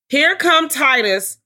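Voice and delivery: high, slow, drawn-out entrance call; mock announcement. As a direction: announce him like a ring introducer.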